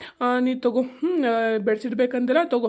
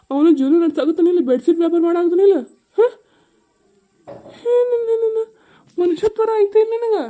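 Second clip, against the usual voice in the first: loud, rising furious rebuke; angry scolding; 3 semitones higher, 7 dB louder than usual. Acted. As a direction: tear into her. Husband scolds wife. (ಕೋಪದ ಗದರಿಕೆ)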